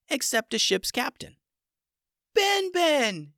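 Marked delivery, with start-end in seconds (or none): 2.3-3.3 high, louder joyful yell. Acted. Stella yells to Ben Ben across the battlefield, overjoyed. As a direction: shout his name with joy. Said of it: "yelled Stella".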